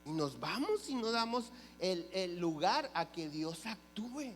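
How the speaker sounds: ordinary talk, nothing marked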